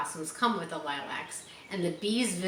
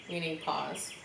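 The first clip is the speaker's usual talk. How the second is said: ordinary delivery, nothing marked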